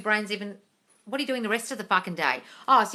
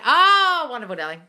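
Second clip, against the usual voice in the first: a big high gushing "Oh"; sweetly over-the-top praise; mock-delighted darling; 4 semitones higher, 8 dB louder than usual. Real, not acted.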